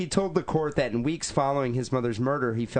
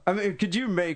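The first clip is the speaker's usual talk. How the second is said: ordinary talk, nothing marked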